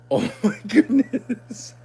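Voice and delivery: 恥ずかしそうに笑いながら; laughing. Real, not acted. Realizes the tank was empty.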